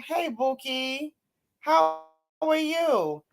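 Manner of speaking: soft, slow, fond hello; lingering, affectionate slow hello; tender greeting. Real, not acted. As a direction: coo it to a dear friend.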